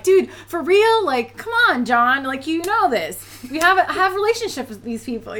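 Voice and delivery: exasperated at a clueless client. Retold or imitated. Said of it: "and I'm like".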